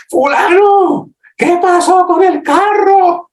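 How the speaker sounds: high, slow, furious yell at the son; hammed-up angry dad yelling at his kid; angry parent yell. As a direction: parent finds the wrecked car and explodes. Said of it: "Eso es lo que va a gritar usted".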